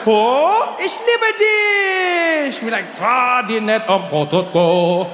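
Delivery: hammed-up high, drawn-out cry of joy; overjoyed imagined father. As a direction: gush in sing-song mock German rapture. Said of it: "he was gonna be like".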